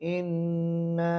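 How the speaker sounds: इन्ना with the nun held long in the nose; very slow इन्ना, nasal nun held; nasal ghunna. Shown as a demonstration. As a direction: hold the nasal hum on इन्ना. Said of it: "ये गुना है".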